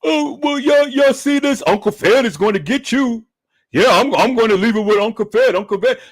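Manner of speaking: mocking, high-pitched babble of a rival. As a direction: imitate him stammering excuses, high and mocking.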